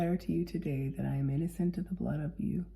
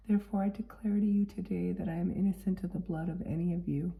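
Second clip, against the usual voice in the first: ordinary talk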